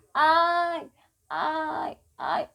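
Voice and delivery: a staged yelp of pain; mock cry of pain. Shown as a demonstration. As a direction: act out a light ouch. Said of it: "grito de dor".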